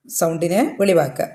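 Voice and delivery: ordinary delivery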